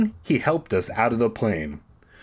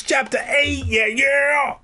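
excited shout. Real, not acted. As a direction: shout it like an announcer. Hyping up the chapter.

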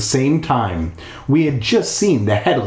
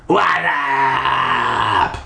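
casual shouted What up in a put-on ghost voice; mock ghost greeting. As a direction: toss it off like a cool dude ghost. Joke voice for the ghost.

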